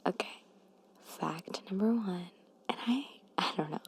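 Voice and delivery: normal talking voice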